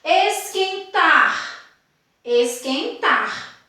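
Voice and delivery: esquentar drawn out slowly, strong final TAR; slow demonstrative esquentar, last syllable stressed. Shown as a demonstration. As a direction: land hard on the TAR of esquentar.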